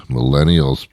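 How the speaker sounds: ordinary talk, nothing marked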